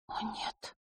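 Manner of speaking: barely audible whispered dismay. A narrator's reading. Hidden girl hears her brother betray her, whispering in dismay. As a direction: whisper it under the breath, dismayed.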